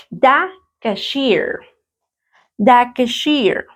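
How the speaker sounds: "the cashier" said slowly and carefully, twice; unhurried teacher modelling "the cashier"; slow word presentation. Shown as a demonstration. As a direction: stretch out "the cashier" for learners. Teacher introduces a new English noun.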